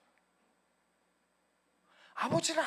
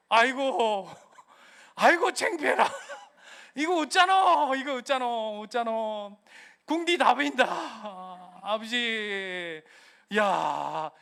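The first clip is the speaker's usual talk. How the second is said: put-on embarrassed child, laughing; 4 dB louder, slower than usual. Kicked-out child at the gate, laughing and mortified. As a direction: wail with shame and giggles at the gate.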